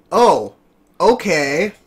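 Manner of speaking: passive indifference. Retold or imitated. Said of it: "So, passive indifference".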